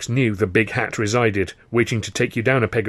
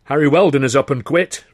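loud, a bit higher; loud announcement; 5 semitones higher, 9 dB louder than usual. Acted. Karno telling the comics the big news, loud. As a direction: announce it to the boys.

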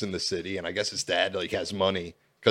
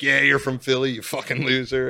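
mocking insult; 6 semitones higher, 7 dB louder than usual. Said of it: "they were like".

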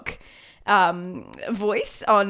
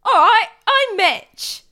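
loud, high comic character voice; Mitch; 10 semitones higher, 7 dB louder, slower than usual. Acted. Podcaster playing Stanley's friend on cue, loud hammed-up voice. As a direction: belt out a hammed-up introduction. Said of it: "Stanley's friend Mitch, played by [name]".

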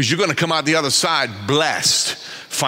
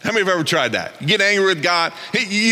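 ordinary delivery

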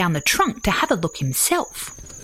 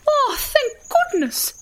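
high, slow, gushing mother voice; fluting platypus mum; relieved exclamation. A narrator's reading. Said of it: "their mother Patricia exclaimed".

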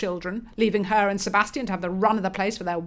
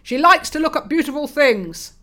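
1 loud lecturing father; 7 semitones higher, 7 dB louder than usual. Acted.

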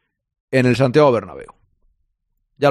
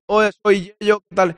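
higher, imitating a stiff robot voice; 6 semitones higher than usual. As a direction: do a toy robot greeting, higher. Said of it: "entra como un robot".

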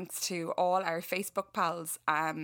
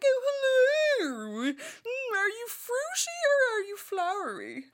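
high, plummy posh shop voice; a hammed-up posh saleswoman; 5 dB louder than usual. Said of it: "put on your posh voice".

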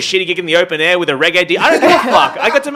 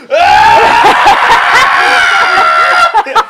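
loud high mock scream of a heckler; imitated scream; 7 semitones higher, 10 dB louder than usual. Comedian mimics the heckler screaming mid-set, loud mock scream. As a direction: shriek like the screaming man. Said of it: "the guy was just like".

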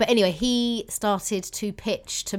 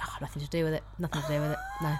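backing off quietly; 3 semitones lower, 8 dB quieter, faster than usual. Retold or imitated. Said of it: "I was just like".

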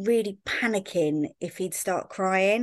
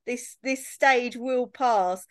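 higher, tearful voice slowing to a soothing close; retold tearful comfort; 6 semitones higher, slower than usual. Stranger mum consoling a tired new mum through tears, slow and tearful. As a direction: comfort her while crying yourself, easing down slowly. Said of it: "she started crying as well".

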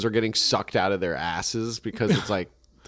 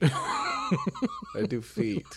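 laughing mock repeat; 6 dB quieter, much slower than usual.

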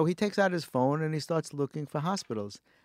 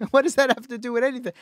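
high, loud, incredulous retort; fast, indignant mock protest; retold exasperation; 11 semitones higher, 8 dB louder, faster than usual. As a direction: throw the question back, exasperated. Acts out retort to pointless question.

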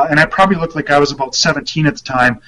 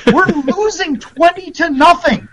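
imitating furious coach; 12 semitones higher than usual. Old coach yells at a young reporter, high and furious. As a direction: blow up like an old coach losing at halftime.

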